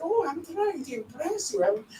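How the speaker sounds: a mild mock of a sweet, eager mum; mother's voice. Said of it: "dia kata".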